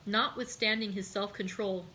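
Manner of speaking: usual speaking voice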